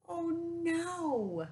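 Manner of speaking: high, dismayed mother's gasp. Retold or imitated. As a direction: voice her dismay in a light, high cry. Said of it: "She's like".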